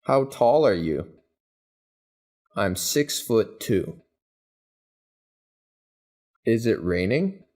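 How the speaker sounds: normal talking voice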